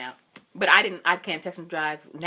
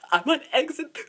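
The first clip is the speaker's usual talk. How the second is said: higher, mock-crying report; imitated tearful voice; 7 semitones higher, 3 dB louder than usual. Crying daughter giving mom her exit, mock tearful. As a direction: choke it out as if crying. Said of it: "I started crying".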